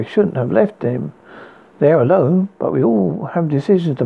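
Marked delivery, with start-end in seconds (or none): none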